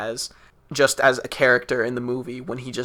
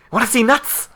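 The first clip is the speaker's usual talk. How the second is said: high, loud lisping quote; a lisping manic Batman line; Michael Keaton lisp; 10 semitones higher, 6 dB louder than usual. Retold or imitated. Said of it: "With his, like, kind of lisp".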